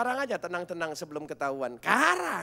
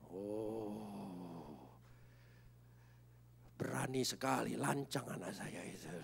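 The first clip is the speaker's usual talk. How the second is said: hushed amazement; 6 semitones lower, 9 dB quieter, slower than usual. Real, not acted.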